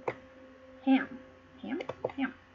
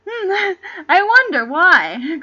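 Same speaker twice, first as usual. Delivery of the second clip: amused laugh; 3 semitones higher, 7 dB louder, much slower than usual. Real, not acted. Reader mocks the name joke.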